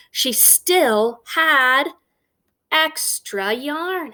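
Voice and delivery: very slow, drawn-out refrain. A narrator's reading. Storyteller drawing out the book's refrain for the child, slow. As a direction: stretch every word of the refrain.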